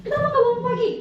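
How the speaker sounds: mock angry teacher demanding why late; scolding teacher. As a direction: scold the late pupil sharply. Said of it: "Udah marah".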